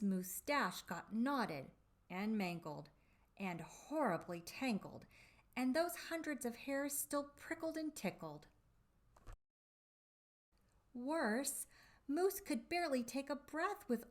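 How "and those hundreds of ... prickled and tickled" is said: usual speaking voice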